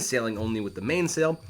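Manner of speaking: usual speaking voice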